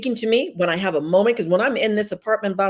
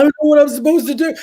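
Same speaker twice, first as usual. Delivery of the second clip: fast, high, louder panicked complaint; doubting believer; 7 semitones higher, 5 dB louder, much faster than usual. Retold or imitated. Preaching to sisters, voicing a doubter's rushed panic. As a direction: rattle it off in a worried rush.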